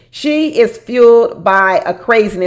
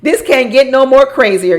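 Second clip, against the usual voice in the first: loud exclaim; 5 semitones higher, 5 dB louder than usual. Real, not acted. Reacting to the show's drama.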